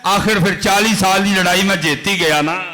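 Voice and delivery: loud, high, impassioned sermon storytelling; preaching at full force. Acted. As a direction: preach it out to the crowd with fire. Tells Junaid story at full force.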